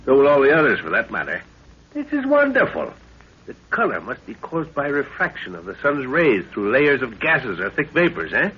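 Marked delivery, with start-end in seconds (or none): 1.9-2.9 awed delight. Acted. Scientist marvels at glowing cave.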